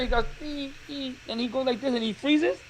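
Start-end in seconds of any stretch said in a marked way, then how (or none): 0.4-1.1 small high scared squeak; El Chavo del Ocho. Retold or imitated. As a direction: imitate a frightened, frozen whimper. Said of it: "El Chavo del Ocho got scared".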